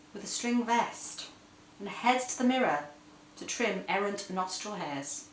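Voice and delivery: ordinary delivery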